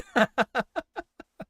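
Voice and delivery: high, loud burst of laughter; helpless loud cackling at a joke; real laughter. Real, not acted. Amused by a chat comment.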